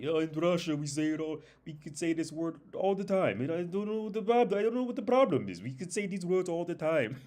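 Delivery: very high-pitched mock-Russian protest; Russian accent. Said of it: "with the Russian accent".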